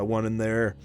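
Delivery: ordinary talk, nothing marked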